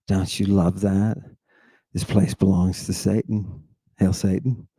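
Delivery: low, soft, ominous voice; dark pronouncement. Pretend. Speaker describes hell to the audience, dark pronouncement. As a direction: pronounce it darkly, low and hushed.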